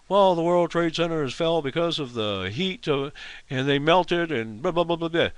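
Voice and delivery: lightly voiced know-it-all, dismissive; mocking the expert. Mocking the yahoo expert's emphatic claim, lightly voiced. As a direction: parody a pompous TV expert, rattling it off. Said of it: "says emphatically".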